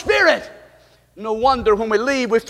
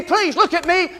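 ordinary delivery

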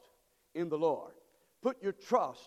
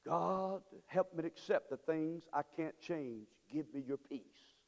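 softer, lower prayer; 3 semitones lower, 5 dB quieter than usual. Acted. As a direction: pray it quietly and humbly. Soft prayer quoted in sermon.

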